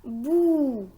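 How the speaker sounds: bou with a long drawn-out oo; isolated syllable. Shown as a demonstration. Teacher gives the answer, bou held long. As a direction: stretch the vowel of bou. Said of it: "la syllabe".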